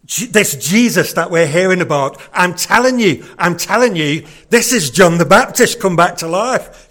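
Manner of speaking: loud, bluff Yorkshire lad calling his mates; rally the lads in broad Yorkshire; Yorkshire accent. Retold or imitated. Herod calling to his boys, loud Yorkshire accent. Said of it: "Herod sounded a little bit like a Yorkshireman".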